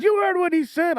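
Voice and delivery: high-pitched indignant mother; upset grandma. Retold or imitated. Mother scolding her son over toddler's remark, indignant. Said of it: "Why are you getting so upset?".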